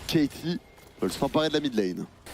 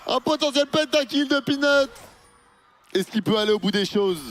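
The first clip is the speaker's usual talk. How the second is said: esports caster; 11 semitones higher, 4 dB louder than usual. Real, not acted. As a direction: sell the pentakill moment. Pentakill hype call. (commentateur esport en pleine hype)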